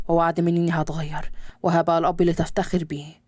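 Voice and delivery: a father's low gentle voice, pitched well below the narration; calm low fatherly pleading. Acted. Father coaxing his son back to his mother, low and gentle.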